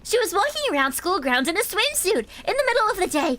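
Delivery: high, indignant accuser; indignant girl voice. Acted. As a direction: report it with outrage. Reads an accusing character.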